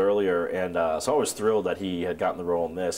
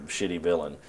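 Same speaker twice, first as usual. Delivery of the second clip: ordinary talk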